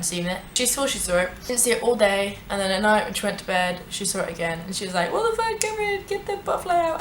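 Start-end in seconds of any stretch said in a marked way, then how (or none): 5.1-7.0 high, thin panicked squeal without volume; mock freaked-out squeal. Friend demanding help with a butterfly, high mock panic. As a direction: imitate a panicking friend, voice pitched way up. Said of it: "screaming over Messenger".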